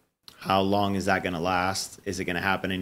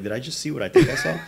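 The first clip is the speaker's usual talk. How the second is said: quick, higher, laughing double take; mock disbelief; 5 semitones higher, 3 dB louder, faster than usual. Stormtrooper turning round after the shooting, mock disbelief. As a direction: voice a stunned bystander, rising at the end. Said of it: "kinda turns around and be like".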